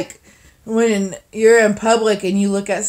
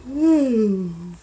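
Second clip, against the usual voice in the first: ordinary talk, nothing marked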